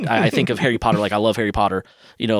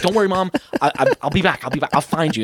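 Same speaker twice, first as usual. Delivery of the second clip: acted-out hurry, high-pitched and stumbling; flustered reassurance; 6 semitones higher than usual. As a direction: reassure Mom while itching to dash off. Retold hurried reassurance to his mom.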